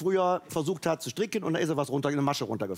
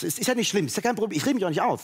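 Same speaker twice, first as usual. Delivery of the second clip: ordinary talk, nothing marked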